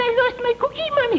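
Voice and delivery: put-on very high squeaky little girl. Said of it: "She say".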